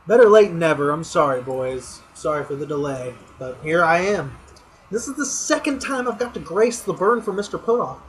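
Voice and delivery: roast your buddy like a big announcement; booming mock-grand burn delivered to a friend; fired-up roast speech. Weekly roast speech to league.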